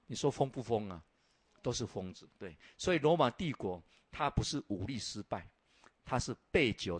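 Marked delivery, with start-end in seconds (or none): none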